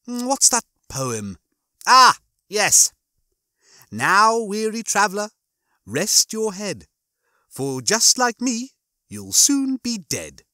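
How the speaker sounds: slow, high mock-poetic recital; Rimmer. Rimmer recites a death verse at Lister, mock-poetic. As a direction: recite it slowly, savouring each phrase.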